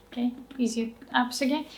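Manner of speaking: ordinary talk, nothing marked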